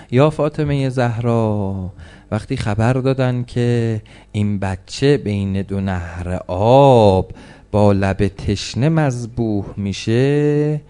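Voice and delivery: a sermon sliding into chanted lament; mourning recital. Acted.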